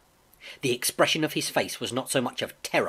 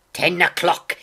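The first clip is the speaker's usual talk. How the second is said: loud, raised burst of alarm; crying out; 5 semitones higher, 9 dB louder than usual. A narrator's reading. Captain reads the note in his palm, crying out. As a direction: exclaim it with sudden force. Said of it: "he cried".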